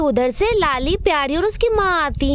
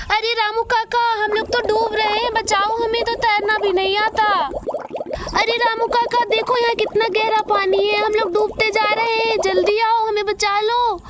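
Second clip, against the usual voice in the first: put-on much higher, frightened child cries; panicking children; 6 semitones higher than usual. Children falling into the ravine cry out to Ramu kaka, panicked. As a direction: shriek for help as you tumble down. Children drowning call for help.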